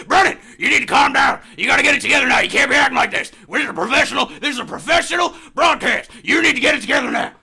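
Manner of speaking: loud, high mock reprimand; Alex Jones scolding. In character, scolding co-host Brennan to calm down, shouting. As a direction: bark orders at the co-host, hammed up. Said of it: "Alex, Alex, go on".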